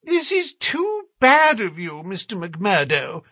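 high, perplexed gentleman's protest; helpless complaint. A narrator's reading. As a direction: complain high-pitched and at a loss. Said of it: "in a perplexed and helpless manner".

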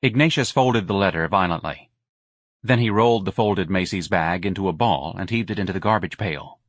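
normal talking voice

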